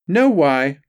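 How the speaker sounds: high, loud sing-song correction. A narrator's reading. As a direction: chant the spelling fix brightly up high. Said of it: "sing-song".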